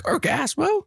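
high, rising incredulous question; mock surprise. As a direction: ask in amused disbelief. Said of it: "They're like".

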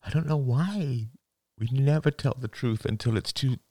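high, halting, choked-up confession; tearful. A narrator's reading. Father apologising to his daughter, tearful. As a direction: force it out through spilling tears. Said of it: "More tears came spilling down".

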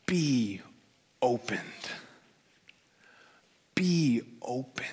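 slow, sighed-out command, each word drawn out; deep sigh. Retold or imitated. Preacher voicing Jesus healing the blind, deeply sighed. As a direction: breathe it out from the bones, unhurried. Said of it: "the deep sigh, he goes, like, from the, from his bones".

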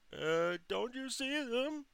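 slow, very high falsetto wail; mock mom yell. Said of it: "my mom yells out".

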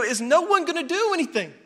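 high, exasperated acted questions; infuriated protest. Onlooker watching corrupt leaders get away with it, infuriated. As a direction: demand answers in mock outrage. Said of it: "It infuriates you".

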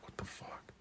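whispered disbelief. Retold or imitated. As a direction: breathe it out in shock. Reacts to late license.

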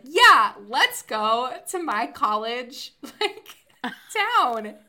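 loud, high, slow-stretched party cheer; rally the friends in an excited mock yell; retold eager cheer. Retelling her own eager suggestion.

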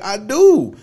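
loud, high insistence; defensive. Retold or imitated. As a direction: insist loudly. Defensive reply.

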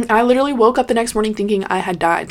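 plain reading voice

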